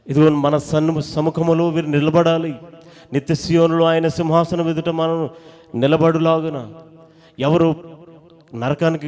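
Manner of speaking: loud, rising, fervent pulpit cry; loud impassioned sermon voice; preaching at full force. Acted. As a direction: preach it out to the whole congregation.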